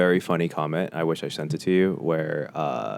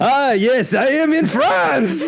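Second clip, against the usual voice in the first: very high, loud, laughing French caricature; French accent; 6 dB louder, much slower than usual. Retold or imitated. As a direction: ham up a giddy French tourist. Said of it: "[name] or Trevor Wallace is like".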